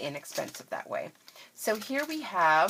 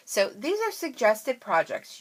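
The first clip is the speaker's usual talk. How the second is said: normal talking voice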